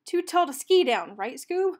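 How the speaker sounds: lightly voiced cartoon slacker; Shaggy voice. A narrator's reading. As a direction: play the jittery hungry Shaggy. Shaggy dialogue, loud high.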